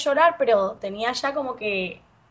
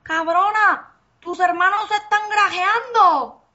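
mock shocked guy; 8 semitones higher, 3 dB louder than usual. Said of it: "el tipo me dice".

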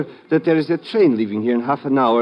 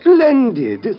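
mock delight; 9 semitones higher, 6 dB louder than usual. Marzak mockingly accepts an invitation.

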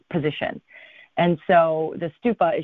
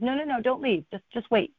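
retold plea, pitched higher; insistent; 7 semitones higher than usual. Abbot asking departing visitors to wait, insistent. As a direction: gently urge them to stay, voice raised in pitch. Abbot stops them leaving.

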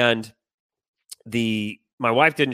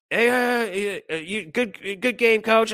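high, stammering jeer; mocking imitation; 9 semitones higher, slower than usual. Rival coach says good game, mocking imitation. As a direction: mimic a snide opponent in a silly raised voice.

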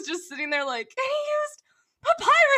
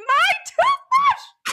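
slow, much higher, much louder shout; real outraged yell at the reveal; 11 dB louder, much slower than usual. Real, not acted. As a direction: yell the punchline in disbelief. Punchline of a gross roommate story.

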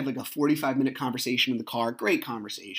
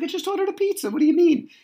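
high-pitched, incredulous squawk, louder at the end; exasperated protest; 6 dB louder, faster than usual. Retold or imitated. Dad who just ordered pizza answering his kids, exasperated. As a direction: protest in comic disbelief, voice shooting up. Mock protest at kids' request.

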